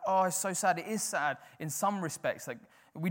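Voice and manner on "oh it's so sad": mock sadness.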